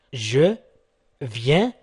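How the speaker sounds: usual speaking voice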